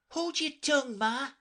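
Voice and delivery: put-on very high, cheeky young voice; a little snappish. A narrator's reading. Young crab to her mother, a little snappish. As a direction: snap back lightly, pitched high. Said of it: "a little snappishly".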